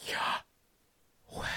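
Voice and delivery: voiceless "yah" in, "weh" out; slow in-and-out breath forming Yahweh; whispered breath. Shown as a demonstration. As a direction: breathe the name without voice. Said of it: "you are whispering his name".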